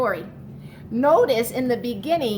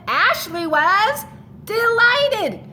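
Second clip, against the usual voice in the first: delighted; 9 semitones higher, 5 dB louder, slower than usual. A narrator's reading. Storybook climax, drawn out.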